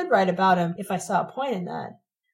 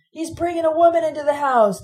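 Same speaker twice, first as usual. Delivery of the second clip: outraged shout, higher and louder; 8 semitones higher, 7 dB louder than usual. A narrator's reading. Father shouting back at his wife, outraged. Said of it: "Tom Matthews shouted".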